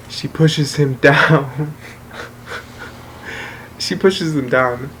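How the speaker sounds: slow telling broken by a laugh. Real, not acted. Storyteller recalling sister's shove of the friend, laughing. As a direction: stretch it out and laugh through the last word.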